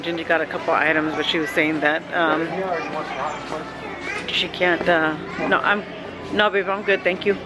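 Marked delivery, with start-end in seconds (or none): none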